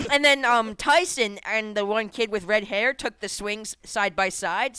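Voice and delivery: ordinary delivery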